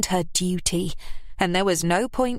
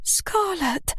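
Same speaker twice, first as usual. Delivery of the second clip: mother starting to plead; 11 semitones higher than usual. A narrator's reading. Kath begins.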